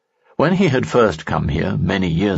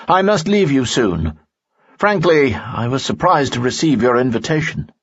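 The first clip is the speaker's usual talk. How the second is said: higher urgent friend; Lanson, higher voice; 6 semitones higher than usual. A narrator's reading. Friend explains to Lee he must leave, higher voice. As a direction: press him, a bit raised.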